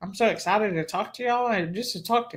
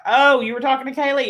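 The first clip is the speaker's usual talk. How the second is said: higher and louder, surprised question; mild surprise; 6 semitones higher, 5 dB louder than usual. Retold or imitated. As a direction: sound caught off guard. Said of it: "They're like".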